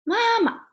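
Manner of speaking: "Mama" high, then a short, quick last syllable; "Mama" with a toneless, fast second syllable; neutral tone ending. Shown as a demonstration. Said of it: "you stop it very fast".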